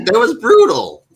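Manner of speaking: loud, high-pitched outcry; emphatic agreement. Real, not acted. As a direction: cry out in pained agreement. Emphatic agreement about painful scene.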